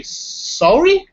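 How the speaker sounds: sarcastic rising Sorry?. Shown as a demonstration. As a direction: throw out Sorry? as a sarcastic jab. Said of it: "when you are being sarcastic".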